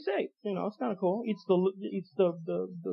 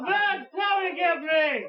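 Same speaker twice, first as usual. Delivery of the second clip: put-on old lady wail; 7 semitones higher, much slower than usual.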